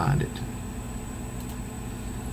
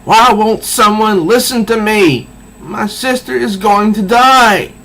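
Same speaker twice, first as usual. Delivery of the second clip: desperate scream, much higher and louder; 10 semitones higher, 5 dB louder than usual. Acted. Alicia screaming at the X-Men to be heard. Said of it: "She s-, literally s-, screams".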